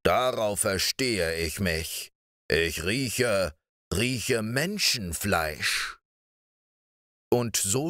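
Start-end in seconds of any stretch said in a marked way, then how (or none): 0.0-6.0 slow giant. Acted. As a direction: draw out the sniffing words like a hungry ogre. (langsame, drohende Riesenstimme)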